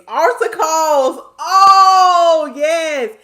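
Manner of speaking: high, very loud drawn-out cry; delighted outburst. Real, not acted. Host recalls younger church days, loud delighted outburst. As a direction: belt it out with laughing delight.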